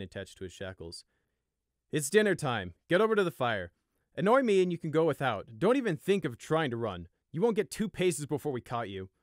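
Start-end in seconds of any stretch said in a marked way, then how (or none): 1.9-9.1 loud, hard, blunt orders. Acted. Guard ordering prisoner to the fire: blunt. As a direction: lay down the rules flatly and loud. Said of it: "the guard told him bluntly".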